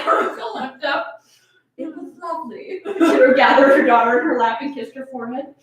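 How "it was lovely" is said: much softer, gentle reassurance; tender. Acted. Mother soothing her daughter, soft and tender. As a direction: soothe a child who's been hurt.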